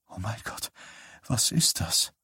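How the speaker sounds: frightened whisper; a doctor whispering in shock at the patient. A narrator's reading. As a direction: breathe it out, barely voiced. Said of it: "flüsterte Wysack".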